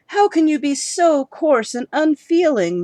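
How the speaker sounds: hurt wife snaps back at husband; bursting out. Acted. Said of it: "Missus Crow burst out".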